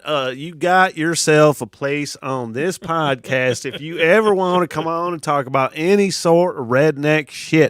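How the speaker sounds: put-on slow Appalachian accent; drawn-out Appalachian speech. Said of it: "that was my Appalachian accent".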